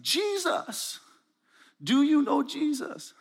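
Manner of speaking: put-on very high voice in a thick African accent. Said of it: "I'll drop the accent for clarity".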